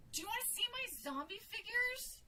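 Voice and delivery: put-on high, loud kid's voice; eager little boy. A narrator's reading. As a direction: ask it high and eagerly, as a child. Boy eagerly offers to show toys.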